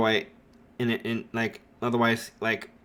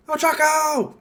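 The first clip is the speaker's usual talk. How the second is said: high, loud joyful shout of her name; excited reply; 4 dB louder than usual. Retold or imitated. Izuku turning to greet Ochako, high happy shout. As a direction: answer her call with delight.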